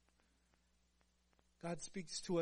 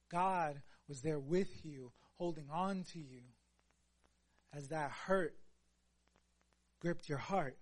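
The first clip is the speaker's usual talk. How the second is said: normal talking voice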